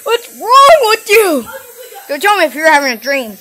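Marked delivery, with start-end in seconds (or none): 0.0-1.4 higher, louder words through laughter; incredulous laughing shout; laughing disbelief. Acted. Laughing at the threat.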